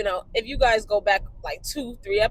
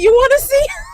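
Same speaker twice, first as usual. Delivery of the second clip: high, loud playful offer; cheeky comeback; 10 dB louder than usual. Retold or imitated. Granddaughter offers to show grandma her piercings, loud cheeky comeback. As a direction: toss it back loudly, teasing.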